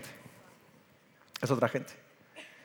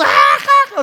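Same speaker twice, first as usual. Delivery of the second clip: high, louder mock laugh of the siblings; mock laughter; 9 semitones higher, 7 dB louder, slower than usual. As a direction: laugh like the kids. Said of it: "y todos". (los hermanos se ríen, risa aguda imitada)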